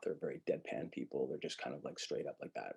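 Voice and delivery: low, soft, flat deadpan; low quiet deadpan voice; deadpan monotone. Shown as a demonstration. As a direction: drone it out with no tune. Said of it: "talk like this a lot".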